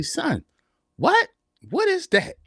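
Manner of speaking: high-pitched, incredulous questioning; baffled dad. Retold or imitated. Father questioning his son about his music, baffled and high-pitched. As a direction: retell a father's puzzled disbelief, voice jumping up high.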